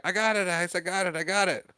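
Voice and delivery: put-on high-pitched kid calling out on the run; mock excited calling. Boy running down the sandlot, high mock calling. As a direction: imitate excited shouts without the volume. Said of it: "he grabs the baseball and screams".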